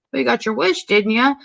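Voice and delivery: lightly acted offhand dig; dismissive. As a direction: imitate someone coolly shrugging it off. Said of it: "she's just very dismissive".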